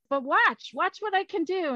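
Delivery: high, eager invitation to watch; animated, boastful prankster; mischievous showing off. Acted. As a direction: show off a trick excitedly. Hancock shows off a prank.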